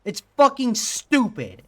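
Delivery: real exasperation, high and loud; exasperated rant. Real, not acted. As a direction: vent loudly at a stupid game. Streamer raging at the game's design.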